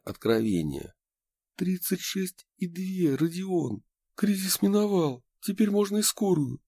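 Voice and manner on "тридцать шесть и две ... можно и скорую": relieved excitement. A narrator's reading. Relieved joyful outburst.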